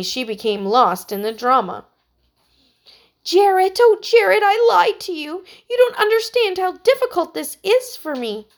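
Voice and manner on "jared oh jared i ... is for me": high, loud melodramatic soap actress; soap opera heroine. A narrator's reading. Heidi watches a TV drama: hammed-up soap heroine. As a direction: overact the tearful TV confession. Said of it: "Heidi gasped ... the shock of the story".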